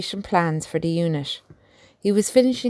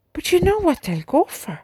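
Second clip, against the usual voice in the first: barely hidden disgust; 10 semitones higher than usual. A narrator's reading. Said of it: "barely disguising the disgust in his voice".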